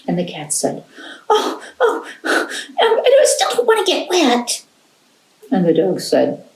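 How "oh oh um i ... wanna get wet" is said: very high whimpering cat voice; whiny cat. Acted. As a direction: whine it up high like a scared kitten. Cat refuses to swim.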